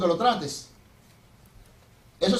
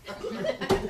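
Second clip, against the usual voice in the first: giggle; 11 semitones higher, 6 dB quieter than usual. Retold or imitated. Sister giggles.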